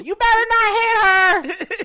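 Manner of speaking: put-on very high, slow, wailing old lady; mock grandma yell. Grandson imitating grandmother warning him, high wailing shout.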